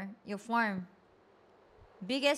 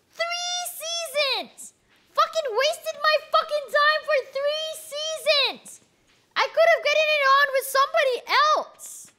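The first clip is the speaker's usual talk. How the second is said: shrieking rant, much higher and louder; angry high-pitched; 8 dB louder than usual. Real, not acted. Streamer betrayed by Sebastian, high furious shouting. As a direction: vent the wasted seasons in a high-pitched fury. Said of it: "My voice gets high-pitched when I'm angry".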